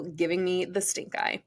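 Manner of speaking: ordinary delivery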